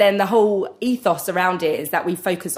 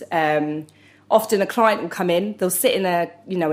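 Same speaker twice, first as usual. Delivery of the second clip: usual speaking voice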